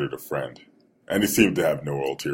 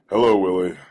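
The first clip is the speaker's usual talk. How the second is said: louder, falsely cheery greeting; mock enthusiasm; 3 semitones higher, 6 dB louder than usual. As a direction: greet him with put-on enthusiasm. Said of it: "in my best mock enthusiastic tone".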